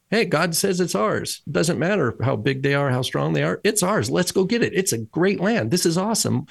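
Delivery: acted-out enthusiasm, voice lifted high; eager rallying report. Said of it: "Joshua and Caleb have one report".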